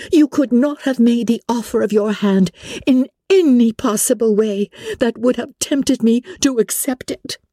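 high-pitched, emphatic rejection; indignant Elizabeth, refusing. Acted. Elizabeth rejects Darcy's offer of his hand, indignant. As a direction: refuse him outright, pitch held high.

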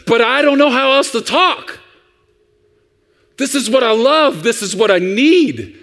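put-on high-pitched kid's protest; imitating a child pleading to be understood. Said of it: "And the child says".

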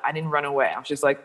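usual speaking voice

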